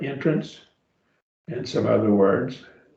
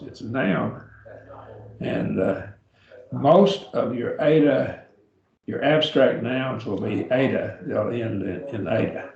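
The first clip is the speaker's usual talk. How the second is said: normal talking voice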